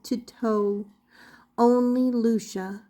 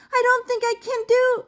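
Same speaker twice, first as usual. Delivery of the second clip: nervous Punchinello; 11 semitones higher, faster than usual. A narrator's reading. Punchinello panics before being cut off.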